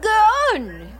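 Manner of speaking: eager. Acted. Host eagerly urging the story.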